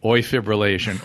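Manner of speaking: usual speaking voice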